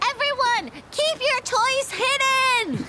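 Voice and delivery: rally the crowd; calling out to everyone. Acted. Player character calls to crowd.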